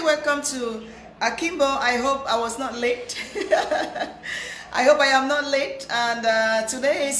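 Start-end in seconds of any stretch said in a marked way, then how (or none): 3.2-4.7 breathy laugh. Real, not acted. Laughs at own lateness.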